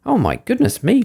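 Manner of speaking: high-pitched, alarmed Goldilocks; startled cry. A narrator's reading. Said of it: "cried Goldilocks".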